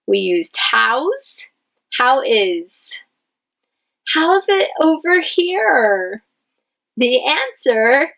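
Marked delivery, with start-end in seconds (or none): 4.1-6.2 higher, slow wondering question; slow curious question. Shown as a demonstration. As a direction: ask it curiously, drawn out. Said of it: "It's a question".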